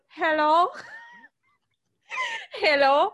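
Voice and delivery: lightly voiced German boy accent; mock German-boy greeting, lifting at the end. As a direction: imitate a stiff attempt at an American accent that comes out German. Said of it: "he sounded like a German boy".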